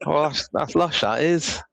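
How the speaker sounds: high Bristol local voice; Bristolian accent. Shown as a demonstration. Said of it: "Bristol's like".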